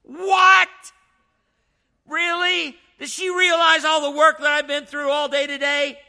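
playing the wronged husband, hammed-up fury at a bologna sandwich; indignant outrage. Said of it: "Now he's angry".

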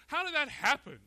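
acted astonishment; incredulous question. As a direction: ask it as if taken aback. Voicing a shocked listener's question.

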